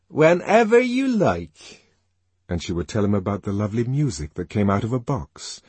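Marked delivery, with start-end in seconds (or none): 0.1-1.9 high, slow, emphatic confirmation; assuring mother. Acted. Mother assuring her son about the other place, slow and emphatic. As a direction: confirm it slowly, stretching each word to a falling end.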